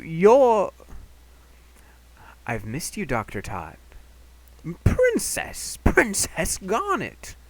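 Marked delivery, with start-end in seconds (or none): 4.8-7.3 put-on high, slow, delighted old man calling; excited old man. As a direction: call out in delighted surprise, very high. Old scholar calling her.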